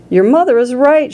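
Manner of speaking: understated urgent shout; mild shout. A narrator's reading. Father backs up mother, mild high shout. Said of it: "shouted Mister Beauregard".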